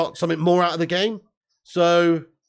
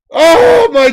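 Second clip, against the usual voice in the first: one burst, much higher and louder; sudden shout; 7 semitones higher, 10 dB louder than usual. Real, not acted. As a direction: jolt at a near miss. Fan reacts to new chance.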